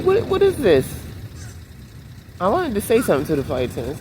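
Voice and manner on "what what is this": mock disbelief. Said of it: "I'm like".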